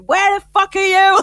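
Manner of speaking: a furious mum shouting down the phone; mock angry shout. Mum ringing the pub, shouting where are they. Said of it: "she's ringing me".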